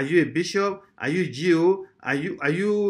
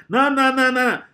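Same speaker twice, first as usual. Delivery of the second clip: high, loud string of noes; insistent pulpit protest; emphatic refusal; 7 semitones higher, 5 dB louder than usual. Acted. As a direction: cut them off with raised, rapid-fire refusal. Rejects commanding God.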